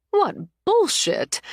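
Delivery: high, drawn-out outraged cry; outraged exclamation. Acted. Mother outraged at daughter's cheating fiancé, high incredulous cry. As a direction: let the outrage burst out high and slow.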